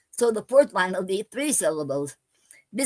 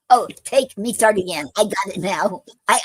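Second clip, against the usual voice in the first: normal talking voice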